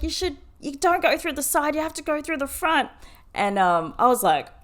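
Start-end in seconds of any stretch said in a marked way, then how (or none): 0.0-2.9 high-pitched scolding bus driver; retold yell. Bus driver scolds passenger over the wrong door, yelling. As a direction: imitate a driver telling off a passenger. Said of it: "yelled at me".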